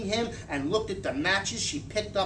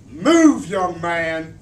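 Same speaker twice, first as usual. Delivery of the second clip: angry order; 10 dB louder, much slower than usual. A narrator's reading. Furious mother orders son.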